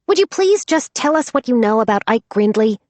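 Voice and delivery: loud exasperated snap; snapping. Acted. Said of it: "Max snapped".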